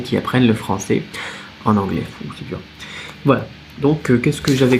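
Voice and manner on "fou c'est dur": soft low aside. Real, not acted. Aside, much softer and lower.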